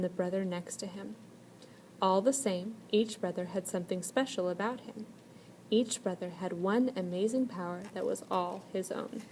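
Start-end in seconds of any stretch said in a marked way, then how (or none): none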